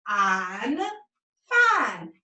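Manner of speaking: teacher blending "fine" with a long i; long vowel blend. Shown as a demonstration. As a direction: sound out "I'm", pause, blend into "fine". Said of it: "say its long sound I".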